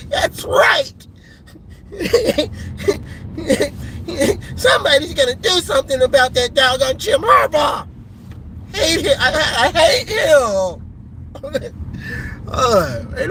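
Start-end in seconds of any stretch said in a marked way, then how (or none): 0.1-0.9 high, laughing mock yell; mock gleeful shout. Vlogger mocking rival fans, high laughing yell. Imitating fan. 4.6-10.8 mock fan rant. Imitating fan hating Harbaugh.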